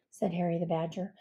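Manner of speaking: usual speaking voice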